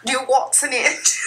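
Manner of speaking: playful, slightly put-on English accent; mock Kate Winslet. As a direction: ham up the English accent as a joke. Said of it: "Did I sound like Kate Winslet?".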